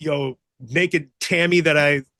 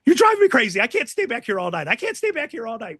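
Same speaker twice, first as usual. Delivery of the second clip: frantic complaint; 6 semitones higher, faster than usual. Retold or imitated. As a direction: whine like a caged man going crazy. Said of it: "he's like".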